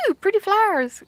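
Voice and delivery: put-on high, wonder-struck little girl voice; imitating a small daughter's delighted coo; a child cooing at flowers on the wall; toddler voice. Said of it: "And she goes".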